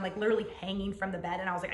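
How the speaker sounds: normal talking voice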